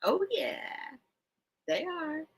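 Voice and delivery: high, soft and smug Oh, yeah; smug reply. Retold or imitated.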